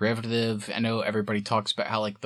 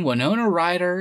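ordinary delivery

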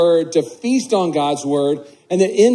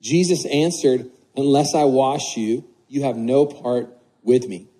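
scripture reading; 3 semitones lower than usual. Acted. Reads the scripture passage aloud.

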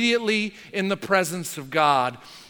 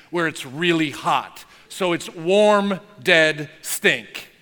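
ordinary talk, nothing marked

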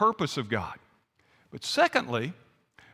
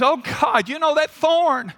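high, insistent appeal to God; imitating an earnest petitioner, voice lifted; acted pleading prayer; 7 semitones higher, 3 dB louder than usual. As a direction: plead your case with raised pitch. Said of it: "and he says".

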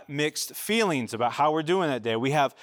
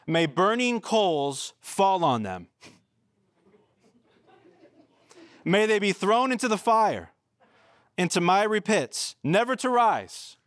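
slow, heavy reading of a curse, rising to a high cry; dramatic scripture reading; 4 semitones higher than usual. Acted. Pastor reads David's angry psalm to the church, slow and heated. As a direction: read the psalm like a prayer hurled at enemies.

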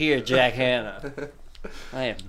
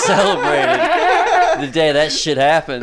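laughing through the words; cracking up mid-rant; 12 semitones higher, 6 dB louder, slower than usual. Acted. Laughing hype about Jack Hanna.

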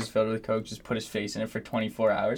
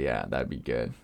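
deep voice; 12 semitones lower, 6 dB quieter than usual. Acted. Drops to deep voice.

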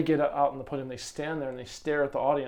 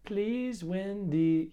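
put-on gentle, high West Indian accent; lilting Caribbean usher voice; sweet West Indian accent; 6 semitones higher, much slower than usual. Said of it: "in the most sweet West Indian accent".

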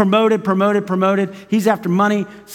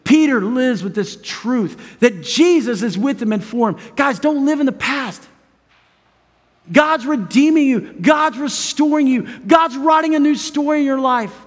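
high, ringing sermon delivery; impassioned preaching; 6 semitones higher than usual. Real, not acted. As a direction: drive each promise home to the congregation. Preaches at full force.

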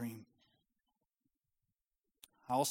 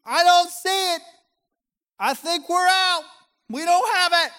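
very high, loud whiny yell; exasperated yelling across the room; 15 dB louder than usual. Retold or imitated. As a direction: complain loudly from the fridge. Husband yells back at fridge.

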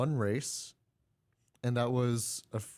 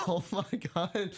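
high-pitched, laughing disbelief; cracking up in disbelief; 7 semitones higher than usual. Real, not acted. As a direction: laugh through the words. Cracking up over McLaren's troubles.

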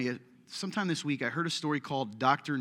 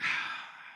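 unvoiced put-upon exhale, imitating reluctance; reluctant sigh; 6 dB quieter than usual. Doctor faced with a sobbing visitor in his doorway, imitated reluctant sigh. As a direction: sigh as if you'd rather not deal with it. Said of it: "he didn't really wanna deal with it".

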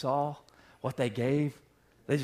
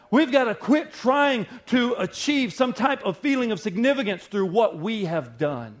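loud, high, impassioned sermon cry; rising pulpit shout; full-force preaching; 9 semitones higher, 6 dB louder than usual. Acted. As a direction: preach it out to the back row.

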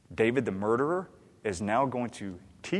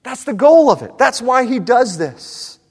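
shouted, high climax of a sermon point; emphatic preaching; 11 semitones higher, 14 dB louder than usual. Real, not acted. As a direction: drive it home at full volume. Preacher drives home his point.

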